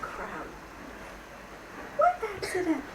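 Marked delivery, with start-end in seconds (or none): 2.0-2.8 put-on a small child asking, higher; curious little kid piping up; a young child asks the storyteller, lightly voiced; young child's voice. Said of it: "often came a very young voice".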